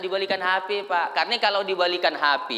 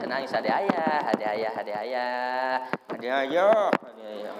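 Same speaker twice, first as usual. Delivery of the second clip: softer, lower tender comfort; 7 semitones lower, 4 dB quieter than usual. Retold or imitated. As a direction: soothe a crying child. Father comforting child.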